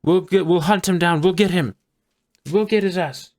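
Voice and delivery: loud, high-pitched guard giving chase; rallying guards. Acted. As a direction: call out like a guard starting a manhunt. GM answers as the guards.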